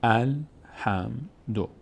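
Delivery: الحمدو very slowly, broken into separate syllables; syllable-by-syllable demonstration. Shown as a demonstration. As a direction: draw out each syllable of الحمدو for learners. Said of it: "مثل اینجا". (شمرده و هجا به هجا)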